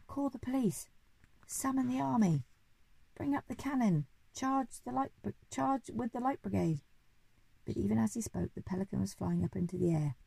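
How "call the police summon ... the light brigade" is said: high-pitched, blustering orders; alarmed commands. A narrator's reading. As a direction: rap out orders in a raised pitch. Duke issues frantic orders, burglar found.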